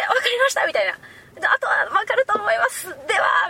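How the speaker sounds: high, mock-formal reply; hammed-up polite voice. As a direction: put on a polite stranger.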